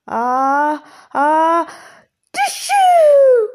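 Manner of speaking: big, loud dragon sneeze building to a very high burst. Acted. Dragon smelling the lemon, building to a loud sneeze. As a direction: wind up and sneeze like a huge dragon. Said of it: "went the dragon".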